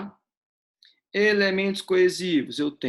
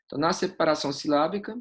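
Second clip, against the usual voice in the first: ordinary talk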